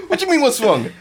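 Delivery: high mock confrontation; indignant retort. Retelling a retort to a man in the road, indignant.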